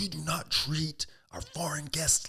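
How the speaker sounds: slow, high, whispered scolding; a hint of a furious boss, hissed slowly; mock furious boss. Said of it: "the guy gets furious".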